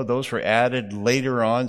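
ordinary delivery

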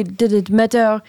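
ordinary talk, nothing marked

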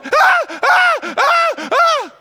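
very high, loud screams in bursts; imitated scream. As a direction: imitate a wife's repeated piercing screams. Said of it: "She's screaming".

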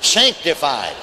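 slow, punched-out testimony shout; challenging. Retold or imitated. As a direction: throw each word at folks like a challenge. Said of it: "like he's challenging folks".

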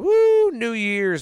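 excited cheer. Acted. Countdown cheer.

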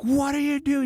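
very high, frantic demand; freaking out. Retold or imitated. Parent confronting grandpa over toddler on tractor, re-enacted freak-out. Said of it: "I'm freaking out".

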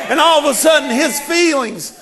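shouting sermon climax; full-force preaching. Acted. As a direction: build to a roaring climax.

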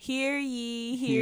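town crier. Pretend. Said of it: "Beyond here lies spoilers".

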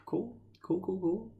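high, soft, breezy cool cool; lightly hammed-up nonchalance; mock casual agreement. Him shrugging off the bigger guy, soft and high. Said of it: "I was like".

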